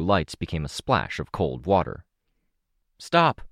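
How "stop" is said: loud, much higher command; calling out. A narrator's reading. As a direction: shout them to a halt. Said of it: "I called out".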